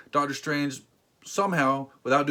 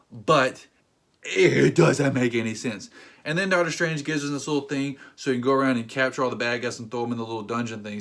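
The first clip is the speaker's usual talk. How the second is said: normal talking voice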